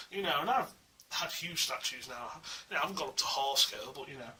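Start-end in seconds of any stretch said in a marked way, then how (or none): none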